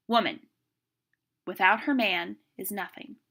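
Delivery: "without her man" as one stressed phrase between pauses; pauses and inflection. Shown as a demonstration. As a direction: pause after "Woman", punch "without her man", drop on "is nothing". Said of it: "using pauses and inflection".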